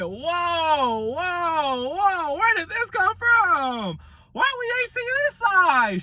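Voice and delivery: high-pitched, drawn-out whoas of amazement; astonished reaction. Retold or imitated. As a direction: cry out in hammed-up disbelief, voice way up high. Acts out own astonished reaction to the win.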